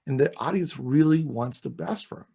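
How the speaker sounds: ordinary talk, nothing marked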